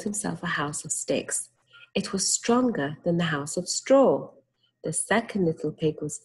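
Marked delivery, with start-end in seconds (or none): none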